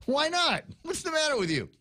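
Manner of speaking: high, disbelieving what-are-you-thinking; incredulous outburst. Retold or imitated. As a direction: protest in mock disbelief. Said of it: "And I'm going".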